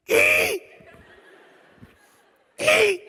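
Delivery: high shrill squeal to dodge the police; toddler squeal. Pretend. Pulled over by police, he squeals like a toddler. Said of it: "Sometimes I like to do that".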